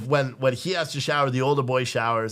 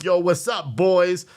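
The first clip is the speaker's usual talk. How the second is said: a light, louder mock headset hello; gamer greeting again; 5 semitones higher, 5 dB louder, slower than usual. Son greeting his boys online, lightly mimicked. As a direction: toss it off like the kid online.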